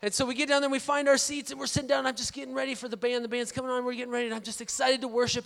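ordinary talk

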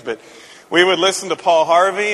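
ordinary delivery

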